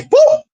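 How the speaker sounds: very high "boop" sound effect; imitated smack. Storyteller acting out grandma's swing, high mock impact. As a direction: pop out a high comic hit noise.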